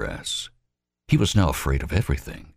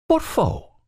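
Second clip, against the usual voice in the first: sharply higher question; alarmed. Acted. Robert Lee to Albert, alarmed. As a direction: jump at it.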